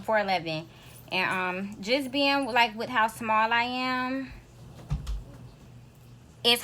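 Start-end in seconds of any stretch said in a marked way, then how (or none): none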